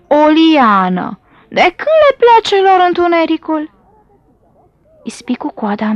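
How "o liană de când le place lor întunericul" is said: put-on voice of an annoyed snake; irritated snake. A narrator's reading. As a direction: draw it out, higher and full of annoyance. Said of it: "se întoarse plictisit".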